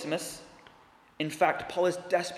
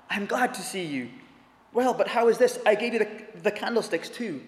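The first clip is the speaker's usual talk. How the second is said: higher, gently astonished bishop voice; kindly old bishop; 5 semitones higher, 3 dB louder than usual. Acted. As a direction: play the old priest surprised and generous. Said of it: "he exclaimed".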